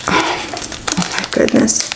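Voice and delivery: breathy awed whisper; hushed amazement. Real, not acted. Amazed by the test result.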